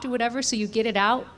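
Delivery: ordinary talk, nothing marked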